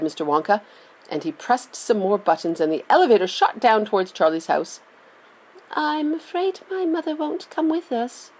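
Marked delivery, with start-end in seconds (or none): 5.7-8.3 put-on higher, softer, downcast boy's voice; a small worried confession; boy admitting his mother will refuse; sadly. A narrator's reading. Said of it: "Charlie said sadly".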